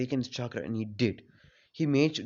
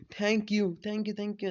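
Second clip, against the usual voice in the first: higher-pitched happy thanks; 6 semitones higher than usual. Retold or imitated. Child hugging the gift-giver, happily thanking him. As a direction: repeat the thanks in a pleased higher voice. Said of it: "Naoto was happy and he hugged".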